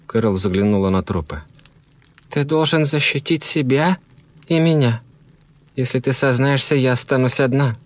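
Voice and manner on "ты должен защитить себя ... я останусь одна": high woman's voice, appealing; pleading wife. A narrator's reading. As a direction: plead with your husband in a high voice.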